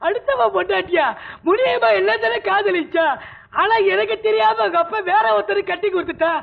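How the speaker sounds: put-on tearful, high drunken wail; crying. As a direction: sob out the grievance of lost love. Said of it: "நீ ஏன் அழுற?". (அழுகையோடு புலம்பல்)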